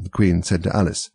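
plain reading voice

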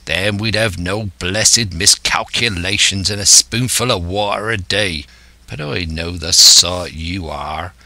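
scornful West Country sea-dog; rolling pirate rant with leaning stresses; old pirate, exasperated. Acted. Silver scolding his impatient crew, exasperated pirate.

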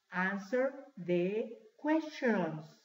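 slow, three separated words. Shown as a demonstration. Teacher translating responde las preguntas for class, word by word. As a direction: say it slowly, a gap after each word. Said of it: "Son tres palabras, no se olviden".

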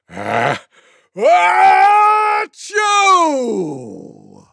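big high fake achoo, drawn out; small ah, then a huge swelling burst; loudest sneeze. A narrator's reading. Tiger outdoing all the others, enormous mock sneeze. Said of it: "gave the loudest sneeze".

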